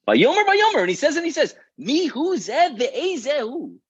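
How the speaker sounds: very high, worked-up sputtering outrage; enraged king. Acted. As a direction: cry out the repeated words in fury. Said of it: "Listen to the words that he says".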